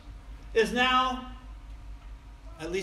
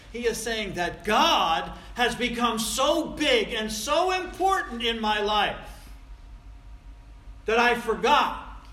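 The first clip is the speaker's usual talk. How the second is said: loud, high, slow and emphatic; full-force proclamation, stretched out; impassioned preaching; 4 semitones higher, 7 dB louder than usual. Real, not acted. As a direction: drive each phrase home to the congregation. Preacher builds to the main point.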